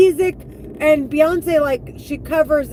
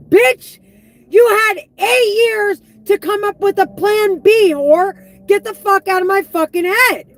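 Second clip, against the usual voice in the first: angry outburst; 5 semitones higher, 4 dB louder than usual. Real, not acted. Yelling back at voices.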